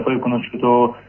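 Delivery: ordinary talk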